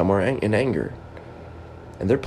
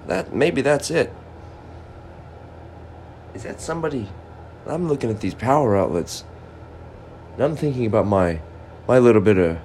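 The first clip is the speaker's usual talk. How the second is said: usual speaking voice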